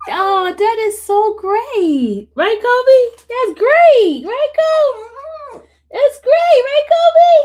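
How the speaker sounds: high, loud, gushing pet talk; excited dog talk. Real, not acted. Owner hypes up the dog Kobe, excited dog talk.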